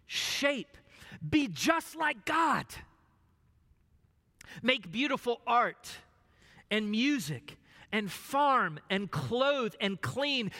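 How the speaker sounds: fervent preacher voice; preaching. Acted. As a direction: preach it with rising fervor. Preaching at full force.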